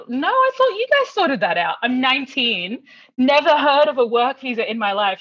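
re-enacted disbelief, high and loud; indignant protest. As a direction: plead ignorance in mock panic. Acted protest to receptionist over visa.

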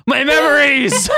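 high, drawn-out melodramatic yell; gasping yell. Acted. Logan gasps as his memories return, yelling. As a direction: yell it high, stretching the words. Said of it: "He gasped and yelled".

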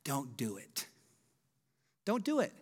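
a hint of anxious friends pleading; lightly voiced friends begging not to go; imitating worried friends. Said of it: "told us".

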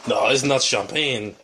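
slow mock French accent; drawn-out, put-on French accent. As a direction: play a French objector with a thick French accent. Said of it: "Try to do a French accent".

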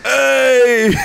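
high loud Italian Hey. Pretend. Mock Italian exclamation.

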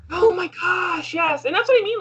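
excited. Real, not acted. Excited agreement about favorite show.

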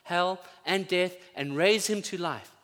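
high, loud, full-force preaching; fervent preaching. Acted. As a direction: preach it out to the whole room.

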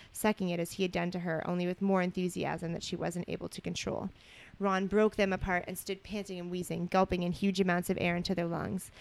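measured storybook reading voice; story narration. A narrator's reading. Co-host reading romantic fan fiction aloud, story narration. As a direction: read it out evenly as narrative.

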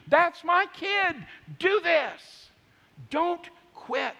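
high, loud, drawn-out rallying shout; cheering him on. Acted. God cheering for you as his kid, high slow shout. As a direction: shout it like a parent urging a child on. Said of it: "God of all creation is cheering for you".

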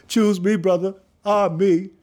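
high, loud, over-eager yelling; eager shout. Retold or imitated. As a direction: shout it out like a kid begging to be picked. Teen jumps up volunteering eagerly.

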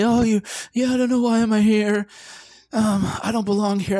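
high, meek, apologetic mock whine; hammed-up timid outsider begging to belong; mock insecure newcomer. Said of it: "I don't say".

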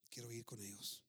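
low, soft, steady reply; quiet resolve. Acted. Widow answering those who brought the news, quiet resolve. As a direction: say it softly and low, unmoved.

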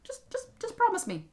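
very high, squeaky exasperation; exasperated leprechaun squeak; impatient little man. Acted. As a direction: brush the objection off, pitched right up. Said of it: "And then said".

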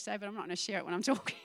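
fast, laughing through the words; real giggles, rushing to get it out. Real, not acted. Preacher giggling about jellyfish, fast laughing aside to congregation. Laughing fit mid-sermon.

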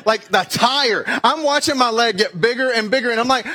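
usual speaking voice

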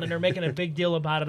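ordinary talk

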